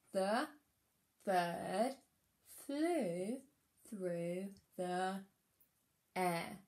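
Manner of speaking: "The bird flew through the air" read one word at a time. Shown as a demonstration. Teacher reading her sentence together with the children. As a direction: read each word slowly for the class to repeat. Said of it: "Let's read it together".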